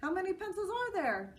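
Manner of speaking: put-on high, bright boy's voice; child voice. A narrator's reading. Joe's voice in story.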